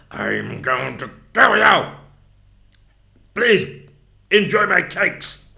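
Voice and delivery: slow, menacing mock killer voice with loud bursts; hammed-up psycho, drawing out the words; serial killer. Speaker mocks a terrible podcast voice, serial killer style. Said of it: "you sound like a serial killer".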